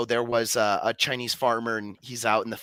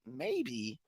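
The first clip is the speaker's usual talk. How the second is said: soft, shrugging "Maybe."; calm farmer; 11 semitones higher, 12 dB quieter than usual. Retold or imitated. The farmer shrugging off the neighbors, soft and calm. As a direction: barely say it, unbothered. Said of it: "the farmer responds by going".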